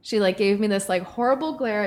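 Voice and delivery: ordinary talk, nothing marked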